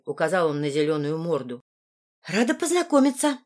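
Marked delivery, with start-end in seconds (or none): 2.2-3.4 higher-pitched, courteous hello; polite greeting. A narrator's reading. Heroine meets Margarita and her companion, polite greeting. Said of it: "решила я быть вежливой".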